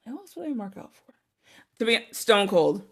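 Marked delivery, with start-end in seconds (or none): 0.1-1.1 very soft, higher-pitched muttering; musing to herself. Real, not acted. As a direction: think aloud under your breath. Thinking aloud to herself.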